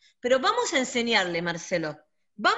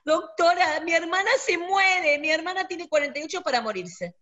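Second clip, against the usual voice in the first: higher, pleading voice of a distraught friend, half-acted; retelling a friend's panicked call, mock tearful and higher; imitating crying friend; 6 semitones higher than usual. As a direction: mimic the friend begging the doctor through tears. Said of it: "ella llorando me dice".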